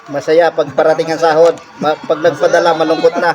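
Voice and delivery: loud joking. Real, not acted. Worker jokes loudly to the vlogger about payday. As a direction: belt it out as a playful complaint.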